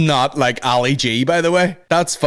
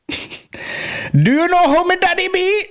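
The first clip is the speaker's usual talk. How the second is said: slow, very high mock Jamaican patois; hammed-up Jamaican boast, drawn out; squeaky Jamaican accent, milking the question; slower than usual. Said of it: "He's at parties like".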